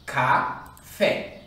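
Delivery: "Café" broken in two, a pause between syllables; split into syllables. Shown as a demonstration. Teacher separating the word's two syllables, split into syllables. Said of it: "Quando eu separo a palavra café".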